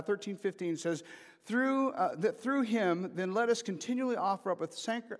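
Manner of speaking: usual speaking voice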